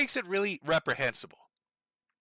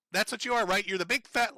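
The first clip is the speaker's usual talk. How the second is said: ordinary talk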